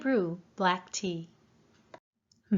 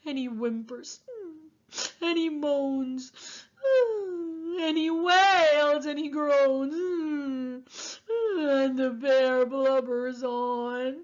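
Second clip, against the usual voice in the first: blubbering bear; 5 semitones higher than usual. A narrator's reading. Bear cries, narrated.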